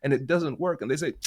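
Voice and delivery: ordinary delivery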